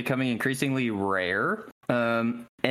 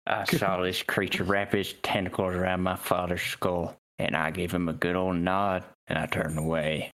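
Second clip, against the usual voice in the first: low, grim southern gunslinger; low southern storyteller voice; mock old cowboy; 7 semitones lower than usual. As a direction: reminisce as a hard-bitten cowboy, pitched way down. Parody of old cowboy recalling trauma.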